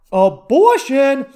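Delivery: loud, high, drawn-out single word; big, stretched-out topic reveal; mock-dramatic announcement. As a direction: belt out the topic slowly.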